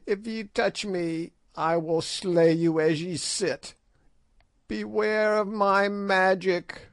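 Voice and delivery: put-on high, menacing old crone voice; a withered witch hissing a threat, pitched high; Gagool, old witch. A narrator's reading. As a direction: ancient sorceress warning off her captors, high and sharp.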